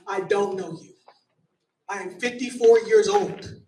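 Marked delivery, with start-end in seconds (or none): none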